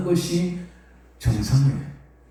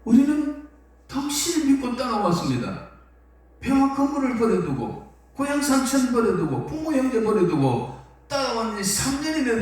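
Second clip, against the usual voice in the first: higher, urgent disciple's plea, speeding up near the end; pleading Peter; 8 semitones higher than usual. Retold or imitated. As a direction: beg him as a follower who gave up everything.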